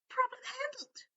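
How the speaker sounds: high, clipped elf voice; smug house elf. A narrator's reading. Dobby dismisses the problem, high elf voice. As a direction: declare it done.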